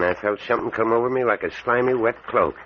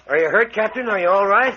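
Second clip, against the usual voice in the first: anxious calling; 10 semitones higher, 4 dB louder than usual. Acted. Helping fallen captain up.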